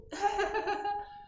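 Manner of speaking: high-pitched put-on giggle; mock giggle at a child. Shown as a demonstration. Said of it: "and do like this".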